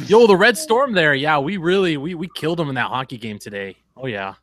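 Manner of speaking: shaky attempt at a northern, near-Canadian accent; hammed-up Wisconsin-like northern accent. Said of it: "need to work on your northern accent".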